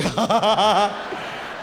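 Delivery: staged laugh in character; put-on laugh. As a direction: laugh to unnerve the passengers. Scaring passengers with immigrant act.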